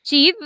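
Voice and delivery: she'd with its final d clearly sounded; she'd, d bien pronunciada; pronounce the d. Shown as a demonstration. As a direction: make the d in she'd heard. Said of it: "es muy importante que hagamos esta pronunciación".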